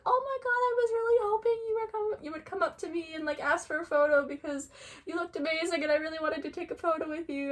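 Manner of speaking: high, eager retold plea; excited, high-pitched mimicry; imitated gushing. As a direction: gush it as someone else's delight. Gushing celebrity quote acted out.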